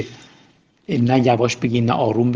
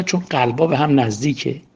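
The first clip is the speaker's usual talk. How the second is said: normal talking voice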